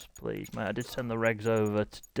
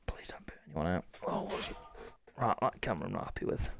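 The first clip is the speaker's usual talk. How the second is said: whisper; 5 semitones lower, 8 dB quieter than usual. Real, not acted. Fretting at the broadcast under breath.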